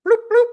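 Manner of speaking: high, loud mock beeping of a car remote; beep noise. Telling viewers about his new car remote, high loud beeping. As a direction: chirp it out like a key fob.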